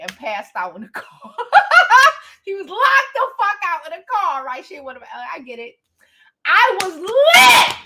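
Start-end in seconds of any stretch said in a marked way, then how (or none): 1.4-2.4 loud high laugh; burst of laughter. Real, not acted. Laughing hard while telling the party story. 6.4-7.8 slow, loud, high shout of it; emphatic shout. Real, not acted. Shouting to her viewers how drunk she got.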